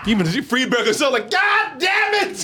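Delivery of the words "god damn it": high, drawn-out mock yell; mock exasperated shout. Host voicing a fed-up spoof director, exasperated mock shout. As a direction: stretch it out in hammed-up frustration.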